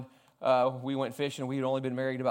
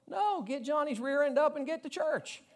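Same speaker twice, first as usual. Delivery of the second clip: much higher, exasperated scold; mock scolding; 13 semitones higher than usual. Preacher to lazy parents, high-pitched mock scolding.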